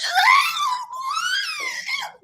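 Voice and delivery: high, wild burst of laughter; a cackling shriek of laughter. Real, not acted. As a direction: break up laughing, shrill and high. Mocking how she fell in.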